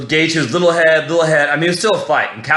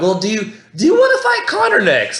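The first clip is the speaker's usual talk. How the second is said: much higher, shouted mock interviewer; interviewer question; 7 semitones higher than usual. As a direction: yell the question like a hyped reporter. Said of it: "They're like".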